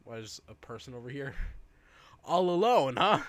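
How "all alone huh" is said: mock-sceptical, louder jab; loud teasing disbelief; teasing all alone. Real, not acted. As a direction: call out the fib with a smirk. Friend teasing the vlogger's claim.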